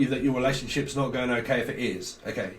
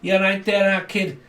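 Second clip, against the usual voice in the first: put-on Scouse, pitched up at first; Scouse accent; 2 semitones higher than usual. Shown as a demonstration. Showing Americans the Scouse accent, put-on Scouse. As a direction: do a Scouser's greeting and warning. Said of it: "that may not have heard the Scouts accent".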